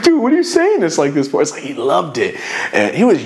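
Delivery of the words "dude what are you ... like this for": incredulous. Retold or imitated. Reacting to cop's drama.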